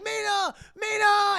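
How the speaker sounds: high, loud cries of her name; mock frantic calling. John and Van Helsing calling to Mina, frantic mock shout. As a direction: call out to her in alarm.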